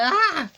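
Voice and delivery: sudden high loud cry of fright; startled scream. Acted. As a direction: scream as something grabs you. Cut off by a sudden scare.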